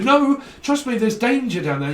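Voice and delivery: high, loud over-careful warning; busybody warning an innocent person, high and loud; mock fussy warner. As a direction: fuss over them like a busybody. Nagging warner voiced.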